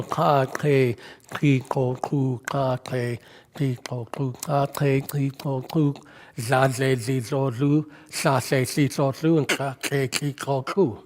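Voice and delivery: ka, ke, ki, ko, ku recited low and even; Zulu click drill. Shown as a demonstration. Preacher recalls Zulu lessons from Simon, click syllable drill on ka, ke. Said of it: "Simon taught me the clicks".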